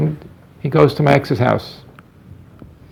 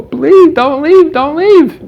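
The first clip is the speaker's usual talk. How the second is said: loud, high-pitched pleading wail; crying son imitated; 14 semitones higher, 5 dB louder, much slower than usual. Son on the floor begging father, imitated crying scream. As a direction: mimic a child begging at full pitch. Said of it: "he's crying".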